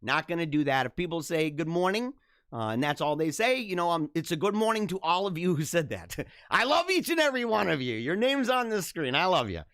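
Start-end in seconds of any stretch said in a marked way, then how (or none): none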